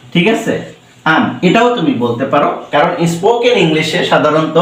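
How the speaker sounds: usual speaking voice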